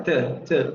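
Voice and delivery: to reduced to "tuh" with schwa; forma débil de to. Shown as a demonstration. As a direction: say to weakly, with a central vowel. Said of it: "the weak pronunciation of to". (to débil, con vocal central)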